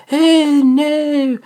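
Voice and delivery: high playful hey no; catchphrase squeal. Retold or imitated. Repeating his catchphrase to fans, high squeal. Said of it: "I said".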